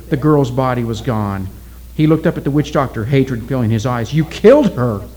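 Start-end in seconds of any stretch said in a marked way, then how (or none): 4.1-5.0 angry accusation. Acted. Oki accuses witch doctor.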